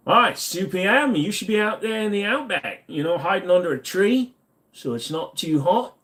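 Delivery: playing at an Aussie accent for the chat; attempted Australian accent. Streamer teasing an outback viewer in an Australian accent. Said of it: "I keep trying to do the accent".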